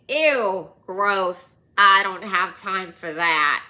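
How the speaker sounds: loud disgusted ew, waving him off; a snooty leader loudly recoils in disgust; disgusted brush-off. Retold or imitated. Religious leader snubbing the dying man, loud and disgusted.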